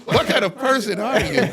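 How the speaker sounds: high, loud laughing imitation of onlookers; laughing mock outrage. Mimicking the onlookers staring at him, laughing hard. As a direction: squeal the disbelief through laughter.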